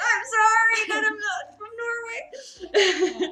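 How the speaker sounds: high, loud, drawn-out wail; laughing mock apology. Apologising to the vendor, laughing mock wail.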